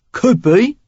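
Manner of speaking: easy, loud Cockney reply; Cockney stranger. A narrator's reading. Stranger teasing Sarah, casual Cockney. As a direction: toss it off like a London rogue. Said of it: "a healthy dose of Cockney in his accent".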